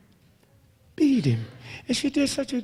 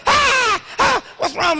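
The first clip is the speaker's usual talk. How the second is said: high, loud panicked holler; 13 semitones higher, 4 dB louder, slower than usual. Retold or imitated. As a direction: shout it out in alarm like an old man. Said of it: "start hollering".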